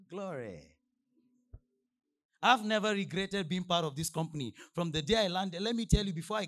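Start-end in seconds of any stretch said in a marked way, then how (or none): none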